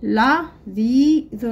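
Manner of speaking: لذيذ stretched slowly; لذيذ drawn out. Shown as a demonstration. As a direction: read لذيذ slowly for copying. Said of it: "لدينا الكلمات نقرأها".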